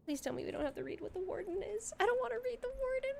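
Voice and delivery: high, fast, frantic whine; panicked self quote. Retold or imitated. Retelling own panic at the walls, high frantic whine. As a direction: rush it like a player in mock panic. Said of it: "I'm, like, looking around like".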